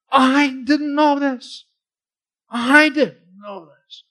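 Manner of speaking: "I" drawn out long and high; slow, amazed emphasis on a stretched "I"; emphatic stress on I. Acted. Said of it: "So he's really making the point".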